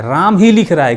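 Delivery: "ही" को ज़ोर देकर, ऊँचा और तेज़; राम ही लिख रहा है — "ही" पर दबाव; stress on ही. Shown as a demonstration. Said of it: "clear है". ("ही" पर ज़ोर देकर साफ़ करते हुए)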